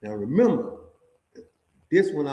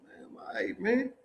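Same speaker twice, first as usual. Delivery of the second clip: whispered, defeated giving in; hushed and resigned; 9 semitones higher, 9 dB quieter than usual. Retold or imitated. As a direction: breathe it out, giving up. Giving in to the guards.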